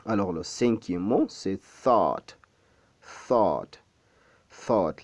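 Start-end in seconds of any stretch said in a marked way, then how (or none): 1.8-5.0 "thought" with airy TH and long open vowel; deliberate "thought", tongue between the teeth; model pronunciation. Shown as a demonstration. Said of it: "La langue placée entre les dents".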